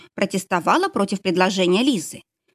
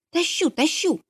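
fussy, hurried and high; flustered; 9 semitones higher than usual. A narrator's reading. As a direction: bustle about hurriedly. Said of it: "засуетилась над его головой Лиза".

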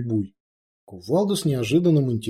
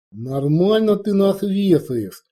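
ordinary delivery, nothing marked

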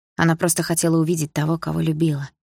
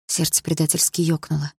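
usual speaking voice

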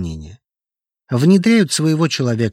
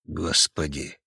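much softer, lower, breath of shock; whispered; 7 semitones lower, 8 dB quieter, slower than usual. A narrator's reading. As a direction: whisper it in disbelief. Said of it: "прошептал Трошин". (прошептал)

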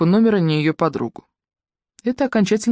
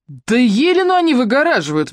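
indignant interjection; 8 semitones higher, 3 dB louder than usual. A narrator's reading. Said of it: "вмешалась Ирка".

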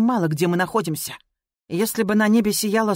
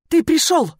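loud, high cry of relief; joyful shout; 9 semitones higher, 4 dB louder than usual. A narrator's reading. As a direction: shout it out, overjoyed. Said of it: "крикнула я, не в силах сдержать эмоций".

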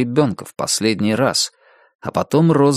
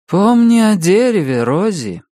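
high, calling woman's voice in the head; raised, ringing voice from memory; Anna's voice; 9 semitones higher, 3 dB louder than usual. A narrator's reading. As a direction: call out a reminder, high then falling. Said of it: "это был голос Анны".

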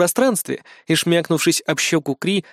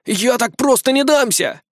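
high, slow defiant cry; boy refusing to surrender, drawn out high; defiant protest; 9 semitones higher than usual. A narrator's reading. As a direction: declare it stubbornly in a high voice. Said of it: "протестовал Лиам".

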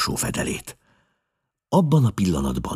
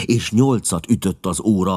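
plain reading voice

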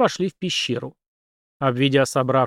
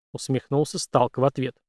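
plain reading voice

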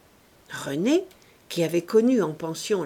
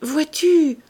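abrupt, higher and louder outburst; sudden curt confession, louder; brusque voice; 6 semitones higher, 6 dB louder than usual. A narrator's reading. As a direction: blurt it out sharply. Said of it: "d'une voix brusque".

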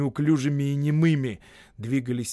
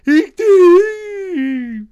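very loud, high whoop; whooping yell; 14 dB louder than usual. A narrator's reading. Brothers whooping as they run for vodka. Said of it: "орут".

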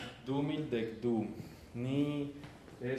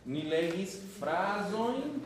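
slow and higher, each word set apart; slow translated model; 6 semitones higher, slower than usual. Shown as a demonstration. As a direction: model it for the class slowly. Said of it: "estoy traduciendo". (lento y más agudo, como modelo)

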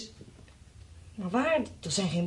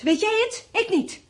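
much higher and louder, cracking with anger; bitter outburst; 11 semitones higher, 4 dB louder, slower than usual. Acted. Wife hurling the question back at her husband, bitter. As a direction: throw it back at him.

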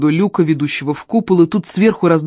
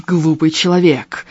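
slow, altered demon growl. Acted. Demon throwing Elm aside, slow growl. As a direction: snarl it like a demon. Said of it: "рыкнул демон".

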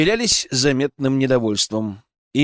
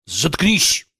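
barked command; 4 semitones higher than usual. A narrator's reading. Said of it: "гаркнул Иоичи".

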